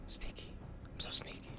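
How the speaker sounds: tiny, hushed stealth whisper; sneaky whisper. Pretend. Gamer creeping past an enemy, in a playful whisper.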